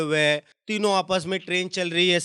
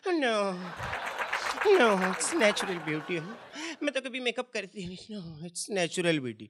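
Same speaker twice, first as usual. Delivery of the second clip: slow, soft, coy drawl; coy lady denial; 5 dB quieter, slower than usual. Retold or imitated. Woman asked about makeup denies it, slow and soft. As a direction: purr it like a vain lady.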